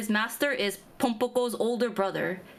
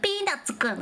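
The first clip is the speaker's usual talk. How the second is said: high, nasal cartoon-boy voice; high nasally character voice; 9 semitones higher, slower than usual. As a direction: squeak the name like a cartoon kid. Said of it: "he talks like this".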